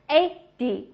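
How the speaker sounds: "eighty" as strong "EIGH", then light "dee"; stress on EIGH. Shown as a demonstration. Teacher drilling tens, stress on the first syllable. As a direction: slowly, punch the start of "eighty". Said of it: "重音都是哒哒".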